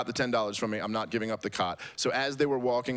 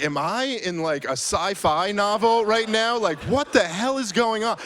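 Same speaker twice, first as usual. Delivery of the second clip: much higher and louder, outraged yelling; furious disbelief; 9 semitones higher, 6 dB louder than usual. Retold or imitated. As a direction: shout it like you can't believe this is happening. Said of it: "I was just getting furious".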